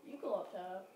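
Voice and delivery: ordinary delivery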